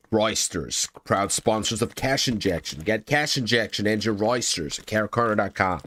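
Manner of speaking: playful sponsor plug voice; mock ad read. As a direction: read it like a cheesy radio ad.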